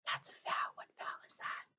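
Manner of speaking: whispered teacher hint. Shown as a demonstration. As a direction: lean in and whisper the question. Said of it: "teacher whispers a hint".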